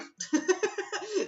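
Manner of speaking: sudden high, loud laugh breaking out; burst of laughter. Real, not acted. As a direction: laugh out loud, caught off guard. Laughs at own Russian struggle.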